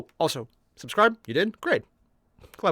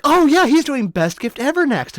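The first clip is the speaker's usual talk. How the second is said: eager fan; 9 semitones higher, 7 dB louder than usual. Retold or imitated. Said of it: "and they were like".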